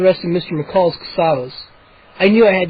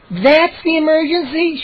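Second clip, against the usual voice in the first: much higher, slow indignant screech; 9 semitones higher than usual. A narrator's reading. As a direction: screech it in disbelief. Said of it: "she screeched".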